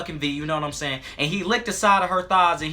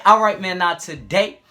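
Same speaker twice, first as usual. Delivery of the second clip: ordinary talk, nothing marked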